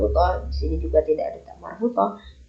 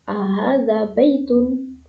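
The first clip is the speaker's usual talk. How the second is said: slow model question; 3 semitones higher, slower than usual. Shown as a demonstration. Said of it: "seperti itu".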